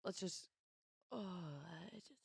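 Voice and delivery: slow, soft goblin-like grumble; goblin-like groan. Real, not acted. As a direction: draw it out quietly, a little like a goblin. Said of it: "you sounded like a goblin there".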